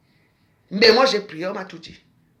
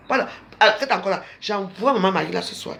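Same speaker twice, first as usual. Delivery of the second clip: ordinary talk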